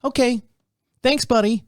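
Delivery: cheery nurse directions; chirpy nurse. Retold or imitated. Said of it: "She's like".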